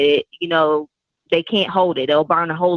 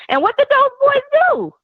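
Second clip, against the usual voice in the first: much higher, louder rhetorical question; incredulous; 4 dB louder, faster than usual. Real, not acted. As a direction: ask it with disbelief.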